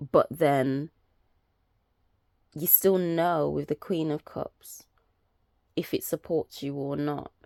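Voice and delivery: ordinary talk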